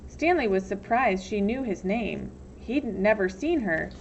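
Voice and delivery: usual speaking voice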